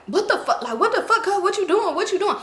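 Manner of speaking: high-pitched mock startled man; startled retort. Man yanked by his shirt, mock startled. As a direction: imitate a man caught off guard. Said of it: "turned around like he seen a ghost".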